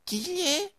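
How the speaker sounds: put-on very high little-child squeak; toddler voice. A narrator's reading. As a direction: ask it as a tiny child. Toddler asks in baby talk. (sehr hohe Kleinkindstimme)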